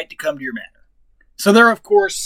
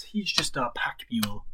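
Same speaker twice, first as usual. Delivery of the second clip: whispering; 6 semitones lower, 14 dB quieter than usual. Real, not acted. Aside about the donkey.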